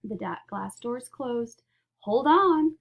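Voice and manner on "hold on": loud high warning call; cried out. Acted. Wonka warning his guests as the lift launches, loud cry. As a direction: brace them with a sharp cry. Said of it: "cried Mister Wonka".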